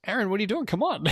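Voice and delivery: high, teasing call with a laugh; laughing high-pitched call to Aaron; playful call upstairs. Retold or imitated. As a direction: coax him up the stairs playfully. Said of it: "And he goes".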